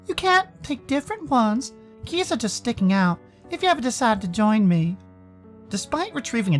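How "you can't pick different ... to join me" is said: higher-pitched, reserved character voice; Sal. Acted. Sal inviting Dave to use a locker, in character voice. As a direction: offer it offhandedly, in character.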